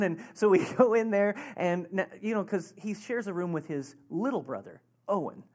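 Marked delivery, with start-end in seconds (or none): none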